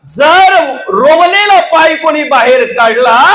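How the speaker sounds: slow, loud, high defiant vow; loud challenge. Acted. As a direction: throw down the challenge to the court. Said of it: "आणि सांगितलं".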